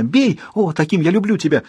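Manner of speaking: high, exultant urging; excited cry. A narrator's reading. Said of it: "вскричала она".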